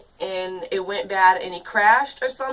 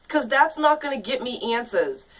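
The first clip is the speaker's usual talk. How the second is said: normal talking voice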